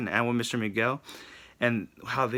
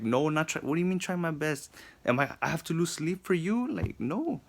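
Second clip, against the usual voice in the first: higher-pitched, incredulous pushback; indignant rant; 5 semitones higher than usual. Retold or imitated. Student protesting to teacher about losing sleep, indignant. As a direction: act out arguing back, pitch climbing on the last no. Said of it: "I know I'm ranting on it".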